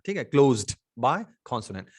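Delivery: ordinary delivery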